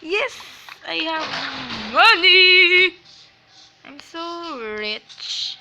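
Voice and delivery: loud slow triumphant yell; shrieking jackpot winner. Real, not acted. Jackpot win in game.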